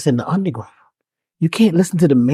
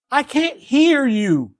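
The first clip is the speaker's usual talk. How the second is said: the same words drawn out, slow and high; deaf frog, slowly; 7 semitones higher, much slower than usual. Acted. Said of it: "He says".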